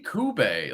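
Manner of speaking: Kube said as koo-BAY; puzzled "koo-BAY" for Kube; questioned pronunciation. Retold or imitated. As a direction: end Kube on a long "ay". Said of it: "Why would it be Kube?".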